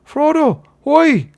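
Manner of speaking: very high, loud hail from afar; high carrying cry; thin voices calling. A narrator's reading. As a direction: call thinly out of the mist. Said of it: "came the thin voices out of the mist".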